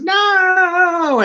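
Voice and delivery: high, anguished No; hammed-up heroine's wail; dramatic cry. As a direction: cry out in mock despair. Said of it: "she goes".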